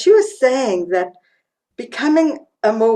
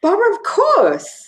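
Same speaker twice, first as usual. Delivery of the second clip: much higher, acted-out exclamation; emphatic mock exclamation; 12 semitones higher, 4 dB louder than usual. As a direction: imitate a friend declaring the obvious. Retold friend's emphatic punchline reply.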